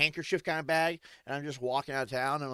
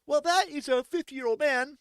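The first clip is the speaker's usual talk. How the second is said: a hint of a breezy high local; mock casual explaining; lightly voiced local; 12 semitones higher, 5 dB louder than usual. Locals shrugging off the newcomer's shock, lightly voiced. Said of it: "They're like".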